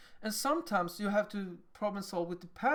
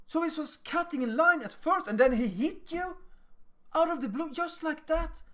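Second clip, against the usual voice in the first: high, louder, incredulous echo; mirroring adult; 7 semitones higher, 4 dB louder than usual. Acted. Adult mirroring a child's story, sympathetic disbelief. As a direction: repeat it back high, taking the child's side. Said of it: "Listen and mirror what they are saying".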